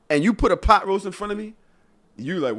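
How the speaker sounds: usual speaking voice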